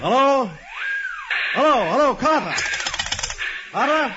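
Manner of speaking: loud, high calls down a dead line; shouting into the phone. Acted. Warden shouting into a dead phone, loud and urgent. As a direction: yell for Carter on the phone.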